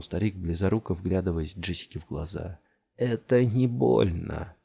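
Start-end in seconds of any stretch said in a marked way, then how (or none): 3.0-4.5 put-on slow, drawn-out old man's voice; an old man murmuring slowly. A narrator's reading. Old man to Jessica, slow and strange.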